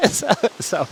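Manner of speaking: high burst of laughter breaking up the words; giggling through a restart; real chuckling. Real, not acted. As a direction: laugh at your own invention while trying to carry on. Laughs at own made-up addition.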